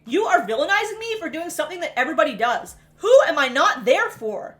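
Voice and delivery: loud, heated acted protest; indignant confrontation. Daughter confronting her mother in a retold quarrel, indignant. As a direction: argue back, punching the key words.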